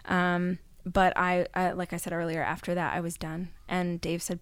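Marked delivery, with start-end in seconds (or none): none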